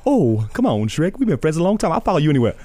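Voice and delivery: pleading sidekick to his grumpy friend, in Donkey's voice; Donkey impression. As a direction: chatter at Shrek like an eager sidekick. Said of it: "Shrek and Donkey".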